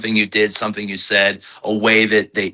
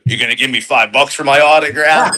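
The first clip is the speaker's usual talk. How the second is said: mock cocky wrestler voice, higher, louder and fast; in-character heel; 6 semitones higher, 4 dB louder than usual. Wrestler in character teasing a little kid, mock cocky heel voice. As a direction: sneer at the kid like a rude heel. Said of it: "he likes to stay in character".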